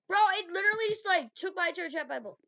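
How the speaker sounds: loud, high complaint; exasperated outburst. Real, not acted. Player interrupted by the game, loud high exasperation. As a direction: burst out in loud protest.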